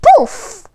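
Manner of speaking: high, loud magic poof. A narrator's reading. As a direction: pop it out like a spell going off. Magic transformation sound.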